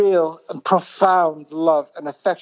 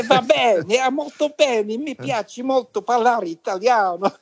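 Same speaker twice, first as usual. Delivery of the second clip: higher, lilting Italian delivery; playful Italian, pitched up; Italian-speaking voice; 6 semitones higher than usual. Retold or imitated. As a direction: switch into your Italian voice, lifted and musical. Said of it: "your voice changes when you speak into this Italian".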